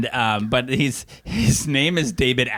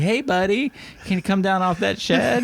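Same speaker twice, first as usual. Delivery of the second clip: lightly raised, higher-pitched coaxing; mild friendly request; 7 semitones higher than usual. Retold or imitated. Dad in a skit asking someone off the shed, polite calling. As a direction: call up politely, holding back the yell.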